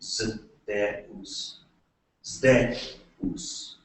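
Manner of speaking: Zeus broken slowly into "zdeh-oos"; Greek pronunciation. Shown as a demonstration. Lecturer modelling the Greek name, Zeus as "zdeh-oos". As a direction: sound out Zeus in two syllables with zd. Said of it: "you say Zeus".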